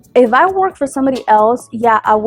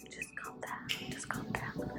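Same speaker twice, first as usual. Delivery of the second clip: barely audible whisper to oneself; whispered self-soothing; 29 dB quieter than usual. Real, not acted. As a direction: whisper it under your breath to settle yourself. Whispers to herself to calm down.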